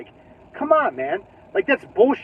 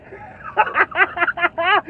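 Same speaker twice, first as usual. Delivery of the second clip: gleeful laugh; 10 semitones higher than usual. Real, not acted. Laughing at own troll.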